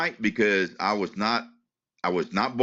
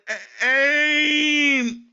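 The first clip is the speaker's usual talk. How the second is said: high, louder strained first word; straining to speak; 4 dB louder than usual. Retold or imitated. Patient forcing out the doctor's name after surgery, high strained effort. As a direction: force the name out with great effort. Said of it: "it was so hard".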